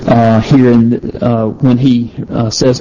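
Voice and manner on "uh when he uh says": usual speaking voice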